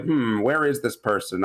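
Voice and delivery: ordinary delivery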